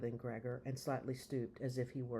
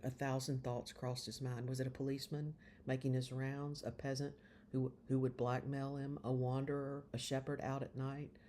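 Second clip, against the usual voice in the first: usual speaking voice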